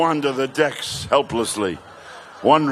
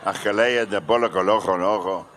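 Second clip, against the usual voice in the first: mock Spanish gibberish; 5 semitones lower than usual. Comic tagging the bit with mock Mexican-accented gibberish.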